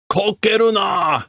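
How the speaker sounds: high, shouted kokeru na; shouted order. Acted. Boss shouting at the workers, high shout.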